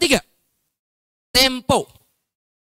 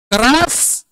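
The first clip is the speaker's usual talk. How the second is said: loud, raised, drawn word KERAS; loud demo; 7 semitones higher, 5 dB louder than usual. Shown as a demonstration. Public speaking teacher showing volume to trainees, loud. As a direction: punch it out loud and high. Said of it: "atau tidaknya suara kita".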